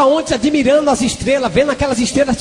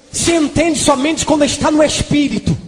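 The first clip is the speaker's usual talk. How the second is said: ordinary delivery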